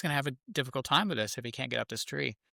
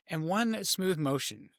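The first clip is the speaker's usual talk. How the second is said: ordinary talk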